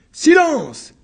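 loud, high barked command; shouted order. Acted. Court assessors silencing the accused, loud shout. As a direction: slam the room to order. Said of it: "crièrent les assesseurs".

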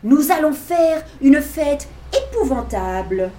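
loud, gleeful declaration; royal proclamation. Acted. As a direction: announce the wild party as king. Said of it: "déclara Max". (Max proclame la fête, fort et joyeux)

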